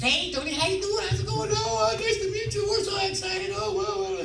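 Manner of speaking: very high-pitched gushing imitation of a woman; excited wardrobe girl. Wardrobe girl greeting the actor on arrival, excited high mock voice. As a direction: mimic her squealing, excited hello in a high voice.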